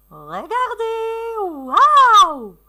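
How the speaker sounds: high, drawn-out excited wow; feigned amazement. Pretend. Teacher showing pupils the fishing game, amazed. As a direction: marvel aloud for young children.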